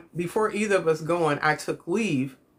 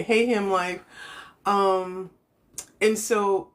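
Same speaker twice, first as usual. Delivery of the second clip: ordinary talk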